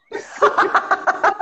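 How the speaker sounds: loud, high burst of laughter; real unrestrained cackling laugh. Real, not acted. Laughing at her own confession.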